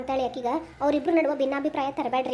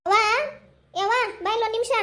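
higher-pitched call to mother; 5 semitones higher, faster than usual. Acted. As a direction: summon mother from another room. Calls mother from another room.

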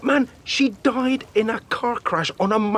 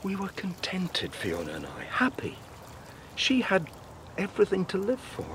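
mournful; 8 dB quieter than usual. Acted. Widower remembers his dead wife.

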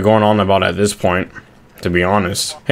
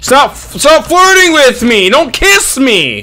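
high, slow, mock-flustered plea; mock flustered squeal; 13 semitones higher, 4 dB louder, much slower than usual.

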